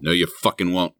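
ordinary talk, nothing marked

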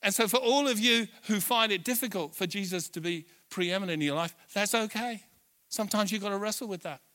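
loud, driving sermon climax; preaching at full force. Acted. Pastor rousing the church, loud full-force preaching. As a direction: preach it out to the whole hall.

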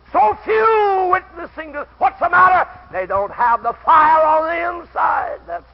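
loud, high exhortation to witness; preaching at full force. Real, not acted. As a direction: drive it home to the pews. Preacher builds to full force again.

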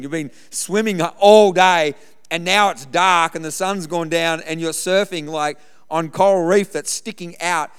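loud imitation of a disbelieving mate; exasperated scolding. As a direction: tell off a reckless friend, loudly. Mate scolding him for surfing.

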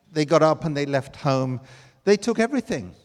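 normal talking voice

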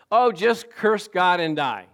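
hammed-up scornful advice; quoting Job's wife. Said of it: "Who said".

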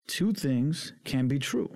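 each word slow and deliberate, "Two things can be true"; slow, stressed repeat. Shown as a demonstration. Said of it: "Let's, let's repeat that".

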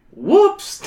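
oops, caught out; whoops. Acted. Hero after melting the bar, mock startled.

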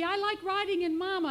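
put-on high, loud protest of a scared baby; crying baby. A narrator's reading. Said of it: "cried baby".

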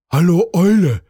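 raccoon calls out loudly to the owl; a cheerful call across the forest. A narrator's reading. Little raccoon calls loudly to the wise owl. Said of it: "rief der Waschbär".

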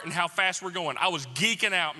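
ordinary talk, nothing marked

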